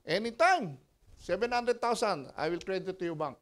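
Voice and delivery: a hint of a brisk bank clerk; bank agent. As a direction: pastor voicing the bank offer. Bank agent voiced in story.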